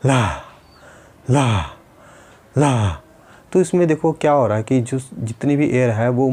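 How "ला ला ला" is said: ला with a sharp puff of air from the mouth; air-pushed la. Shown as a demonstration. Therapist demonstrating ला for pupils, pushing air. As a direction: push ला out of the mouth, not the nose. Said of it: "जैसे".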